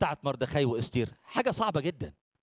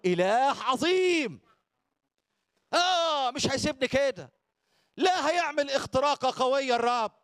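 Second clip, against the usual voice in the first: faith-filled declaration; 8 semitones higher, slower than usual. Acted. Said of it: "ما تقول".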